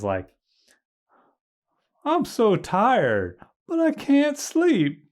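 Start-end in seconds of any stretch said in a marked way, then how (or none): 2.0-5.0 much higher, slow and louder whiny voice; brain in a jar. Retold or imitated. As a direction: stretch it out like a tired brain in a jar. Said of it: "the thing that made me laugh is".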